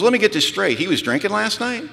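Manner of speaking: mock cross-examination; incredulous questioning. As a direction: check the facts in disbelief. Incredulous sponsor interrogates, acted.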